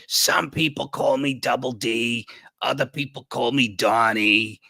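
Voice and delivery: put-on raspy voice with a New York accent; grandma-like rasp, New York vowels. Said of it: "why'd you do a New York accent for him?".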